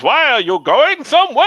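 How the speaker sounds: high, slow movie-hero holler; Christopher Lambert. Acted. Highlander calling out to the Kurgan, Lambert impression. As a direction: stretch it out into a high call. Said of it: "Christopher Lambert when he's calling out".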